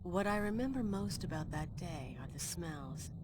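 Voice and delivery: hushed storyteller recalling the past; reflective narration. A narrator's reading. Older Amelia narrates the aftermath.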